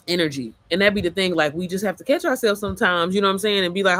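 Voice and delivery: ordinary delivery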